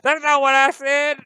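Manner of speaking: loud, high, slow indignant yell; shouting. Acted. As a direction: yell it from the background. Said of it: "the person in the background shouts".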